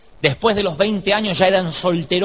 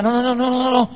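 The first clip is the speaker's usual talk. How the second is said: quick string of higher-pitched no's; insistent refusal; 7 semitones higher than usual. Retold or imitated. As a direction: brush the objection aside, imitating an insistent man. Secretary brushes off the objection. (negativa insistente, más aguda)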